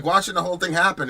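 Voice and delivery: ordinary talk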